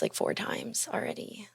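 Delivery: whispered, half-hiding the words; whispered confession. Real, not acted. Confessing to her viewers in a whisper. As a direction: let it slip out as a secret whisper. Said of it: "I haven't even told you".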